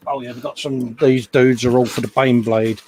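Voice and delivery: ordinary delivery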